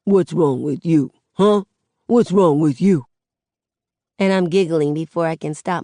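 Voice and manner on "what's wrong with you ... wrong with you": playful mock-deep daddy voice. A narrator's reading. Daddy coaxes his upset daughter to giggle, mock deep voice. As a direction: put on a deep voice to tease, a bit louder. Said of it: "in a real deep voice".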